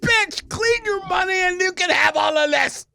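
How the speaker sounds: high, strained exasperated yell; exasperated cursing. Retold or imitated. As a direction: re-enact cursing at the screen, pitch way up. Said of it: "I'm sitting there just like".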